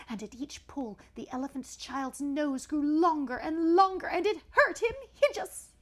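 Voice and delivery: loud, high, straining storytelling; frantic climax. A narrator's reading. Storyteller as the nose is stretched painfully, loud and straining. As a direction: drive each pull harder, loud and high.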